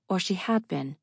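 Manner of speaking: ordinary delivery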